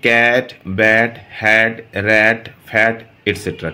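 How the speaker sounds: Cat bat hat slowly, each word set apart; slow model words. Shown as a demonstration. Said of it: "যেমন ধরো can".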